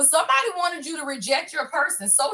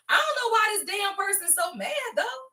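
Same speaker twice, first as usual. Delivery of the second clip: exasperated outburst; 8 semitones higher than usual. Real, not acted. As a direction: blurt it out in baffled disbelief. Reader baffled by an angry card.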